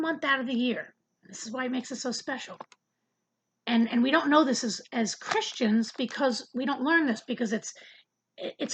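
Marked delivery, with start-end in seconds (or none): none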